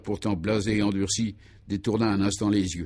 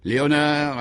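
firm, higher-pitched summons; suddenly hardened; 6 semitones higher, 4 dB louder, slower than usual. A narrator's reading. Commissioner calls his inspector, voice suddenly hard. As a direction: call the henchman in a hardened voice. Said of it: "appela-t-il d'une voix subitement durcie".

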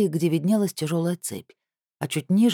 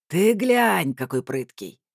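mock amazed gasp; slower than usual. A narrator's reading. Thug watching the captive jerk, amazed gasp. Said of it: "охнул второй".

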